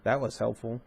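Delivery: dry, sarcastic, in a changed voice; sarcastic snort. Acted. Jacob scoffing at his father's unhelpful answer, sarcastic. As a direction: throw the words away with scorn. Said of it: "he snorted".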